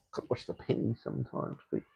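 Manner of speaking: a muttered unvoiced aside; whispered aside. Real, not acted. Teasing a penny-pinching viewer under his breath, whispered. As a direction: drop to a whisper, half to yourself.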